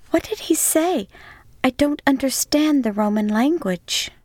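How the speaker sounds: Miriam pleading over a wounded man; pleading woman. Acted. Miriam confused, asking Cyril.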